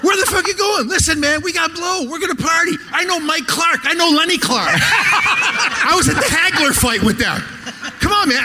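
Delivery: high, loud, insistent party guy; pushy drunk guy. Retold or imitated. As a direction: imitate the birthday guy begging him to party. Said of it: "He goes".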